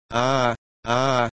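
lightly acted howls of torment; mild yell. A narrator's reading. Eric starts yelling and jumping about on deck, mild yell. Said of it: "Robinson ordítani és ugrándozni kezdett".

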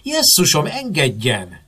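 high, loud yelp dropping off; startled female outcry; startled scream. A narrator's reading. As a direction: cry out in sudden alarm. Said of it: "Aztán felsikoltott".

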